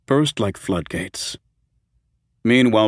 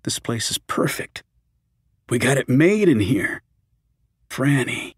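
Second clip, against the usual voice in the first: comforting; 3 semitones higher than usual. A narrator's reading. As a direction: reassure her gently. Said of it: "trying to comfort her".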